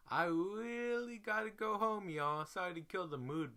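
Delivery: high, loud, whiny "I gotta go"; whiny plea. Retold or imitated. Telling the crew she's done, high and whiny. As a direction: beg to go home in a high, whining voice. Begging to leave after the beating.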